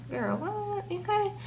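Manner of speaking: a stunned high little what; high incredulous. Retold or imitated. Jingles reacting in disbelief.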